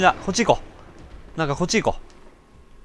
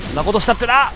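mock scolding shout; 7 semitones higher, faster than usual. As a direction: raise it on そんなことしたってな, rising at the end on な. Lecturing the enemy, raised.

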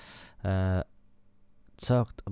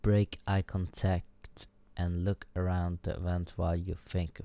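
usual speaking voice

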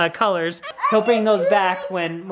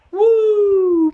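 cheer; 9 semitones higher, 6 dB louder than usual. Real, not acted. Cheering the dice roll.

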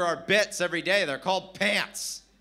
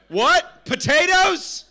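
loud, very high questioning yelp; high, loud, baffled comic reply; mock incredulous server; 11 semitones higher, 9 dB louder, much slower than usual. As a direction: mishear it in drawn-out disbelief. Acts out server mishearing the order.

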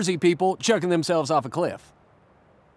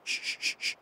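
whispered shh, shh, shh; mock shushing; 13 dB quieter than usual. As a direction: hush the group as if something lurks. Mock camper hushing the group.

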